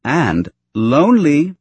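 "lonely" slow, high and loud; teasing threat. A narrator's reading. Wife threatening her husband, teasing stress on "lonely". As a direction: stretch "lonely" upward as a playful warning.

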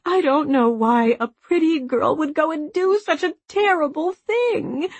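high-pitched mother's lament; mournful. A narrator's reading. Mother lamenting a daughter's elopement, mournful. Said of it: "in a mournful voice".

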